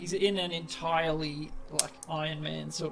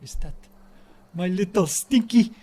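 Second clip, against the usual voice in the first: a goblin's doting call, louder; goblin greeting; 3 semitones higher, 9 dB louder than usual. Acted. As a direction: coo at your little stinky like a proud goblin. Goblin host greets beloved Sugo.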